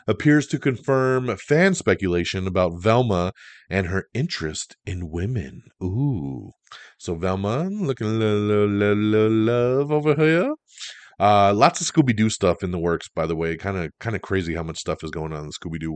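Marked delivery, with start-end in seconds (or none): 5.8-10.5 teasing ooh. Acted. Teasing about Velma romance.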